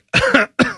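forced little cough; fake cough. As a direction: cough like you're faking sick. Faking sick to boss.

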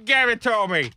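high, drawn-out Boston come-on; mock Boston accent. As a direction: ham up a bedroom plea in Boston accent. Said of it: "I will take the Boston accent".

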